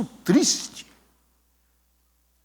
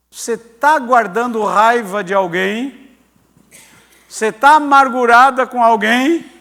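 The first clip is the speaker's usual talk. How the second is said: slow, loud, weighty questioning; probing confrontation; 7 dB louder, slower than usual. Retold or imitated. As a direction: press each question home deliberately. Pastor confronts the girl with questions. (pergunta incisiva, lenta e forte)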